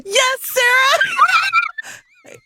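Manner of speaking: high, loud, drawn-out laughing shout; a real delighted whoop; laughing cheer. Real, not acted. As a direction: cheer her name through laughter. Cheering the sex scene.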